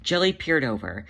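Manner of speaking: ordinary delivery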